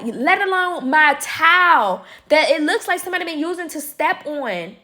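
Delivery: retelling how she told off her roommate, high and heated; telling off. Retold or imitated. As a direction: lay down the law to the roommate.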